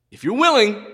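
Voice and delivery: If you're willing sent up high, pleading; pleading leper. Retold or imitated. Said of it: "So you know what he says?".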